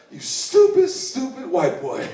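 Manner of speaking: exasperated put-down, mock-hammed; mock scolding inmate.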